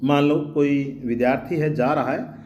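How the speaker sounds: ordinary talk, nothing marked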